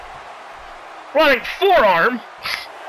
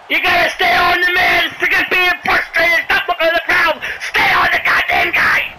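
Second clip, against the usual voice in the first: raised, frustrated shout; exasperated scolding; 4 semitones higher than usual. Real, not acted. Commentator berating his distracted wrestler, exasperated shout. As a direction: yell at your fighter to focus.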